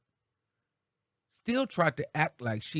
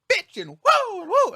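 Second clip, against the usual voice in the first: high, much louder mock yelling; 1 mock angry yelling; 8 dB louder, slower than usual. A vlogger mocking Tasha K getting mad, shrieking mock yell. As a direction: squeal it out like a ranting host. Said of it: "She literally gets mad".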